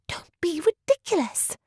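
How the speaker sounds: sharp unvoiced high whisper; tense whisper. A narrator's reading. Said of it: "Georgiana tensely whispered back".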